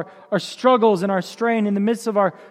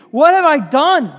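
anguished outcry; 5 semitones higher, 7 dB louder than usual. Acted. Climax of repeated remorse lament.

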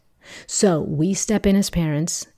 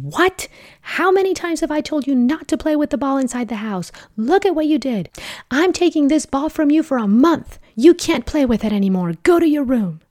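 high, loud scolding shout throughout; furious parent yelling; 10 semitones higher, 4 dB louder than usual. Pretend. Parent punishing the child for playing ball inside, furious yelling. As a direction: rant at the child like you've lost your temper. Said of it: "if you yelled something like".

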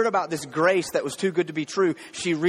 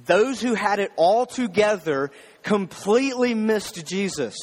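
usual speaking voice

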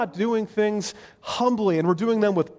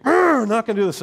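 louder, mock whiny refusal; sulky kid; 5 semitones higher, 6 dB louder, faster than usual. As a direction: play the kid who won't get dressed. Kid refusing church clothes.

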